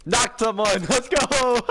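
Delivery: sing-song victory cheer; triumphant shout. Real, not acted. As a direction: cheer the hit loudly. Celebrating a knock in game.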